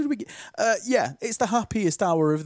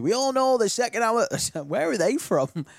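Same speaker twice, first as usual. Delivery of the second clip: higher, bouncy TV presenter voice; Ant and Dec impression; 7 semitones higher than usual. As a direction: mimic a cheesy Saturday night host. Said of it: "that's my impression of Ant and Dec".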